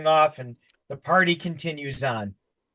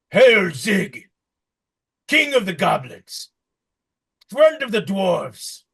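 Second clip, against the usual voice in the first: dwarves hailing; 3 semitones higher, 4 dB louder, slower than usual. Acted. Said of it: "you hear the dwarves go".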